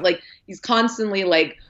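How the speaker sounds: ordinary talk, nothing marked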